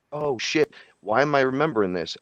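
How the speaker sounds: high-pitched sudden oh shit; startled realisation. Retold or imitated. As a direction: jolt of sudden recognition. Retold startled realisation in vision.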